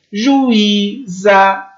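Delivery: Juíza in separate syllables, ju-Í-za; Juíza broken up, stressed I on its own; I standing alone. Shown as a demonstration. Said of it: "o I fica sozinho". (Juíza separando as sílabas: ju-Í-za)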